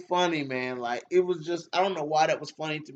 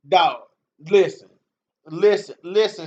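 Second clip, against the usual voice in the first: loud call for attention; 2 semitones higher, 9 dB louder than usual. Real, not acted. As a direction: shout at them to listen up. Calling out to his chat.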